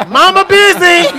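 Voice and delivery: mock mama voice. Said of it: "you say".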